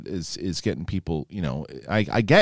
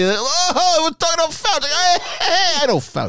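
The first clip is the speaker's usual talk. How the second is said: excited. Pretend. Bragging to cohost about knowing Fauci, high. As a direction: brag up high like a buddy.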